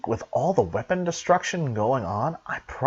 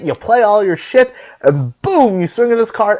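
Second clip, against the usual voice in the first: usual speaking voice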